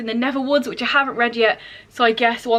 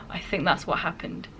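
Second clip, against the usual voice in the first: sheepish whisper, lower and much softer; whispered aside; 6 semitones lower, 8 dB quieter than usual. Real, not acted. Admitting she invented the book's concept, whispered. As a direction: confess it under your breath.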